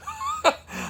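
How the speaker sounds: very high, short burst of laughter. Real, not acted. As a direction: laugh at the absurdity. Laughs at his retold comeback.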